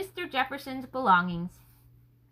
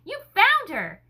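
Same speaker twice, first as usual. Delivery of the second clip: high, loud, slow cry of joy; joyful exclamation; 7 semitones higher, 6 dB louder than usual. Acted. Jefferson greeting Nathaniel with the lost mare, joyful high exclamation. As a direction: burst out with delight, drawn out. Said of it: "with an exclamation of joy".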